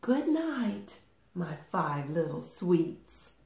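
plain reading voice

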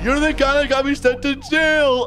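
high-pitched, heated accusation; outraged accusation. Acted. Kidnapper recognizing man who got him jailed, outraged.

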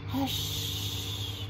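whispered, drawn-out hush; mama's hush. Acted. Mama hushing the lizard, whispered. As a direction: breathe out a long shush. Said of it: "she's saying to the lizard".

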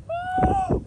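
a sky-high woo of triumph; high whoop. Real, not acted. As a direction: let out one big high whoop. Cheering the fish.

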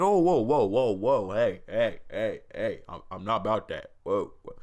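hammed-up backing away, hands up; mock flustered recoil. Vlogger mocking a creator's awkward flinch, hammed-up startled recoil. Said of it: "You're like".

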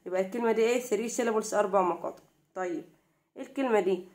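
normal talking voice

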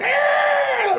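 long, high mock scream; sustained high-pitched shriek as the devil; devil's scream. Preacher mimicking the devil of religion, mock scream.

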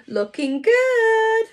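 slow, high, showy admiring drawl; a hammed-up admiring look in the mirror; looking-good mirror pose. Shown as a demonstration. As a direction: preen at your reflection. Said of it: "draw a mirror and go".

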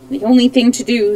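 usual speaking voice

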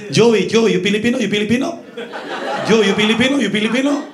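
high, loud eager questioning; eager Filipino stranger. Retold or imitated. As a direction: pester him excitedly. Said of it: "they just start speaking".

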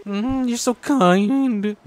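high, very drawn-out cooing; gushing mock sweetness. Player thanking a teammate, playful drawn-out coo. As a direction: coo it slowly, playfully overdone.

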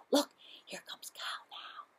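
hushed anticipation whisper; excited whisper. A narrator's reading. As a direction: whisper like a surprise is coming. Excited hush as Cow arrives.

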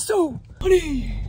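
breathy mock husband voice; airy voice. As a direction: exaggerate the air in his voice. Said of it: "you have air in your voice".

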